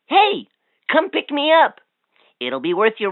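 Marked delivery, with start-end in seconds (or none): none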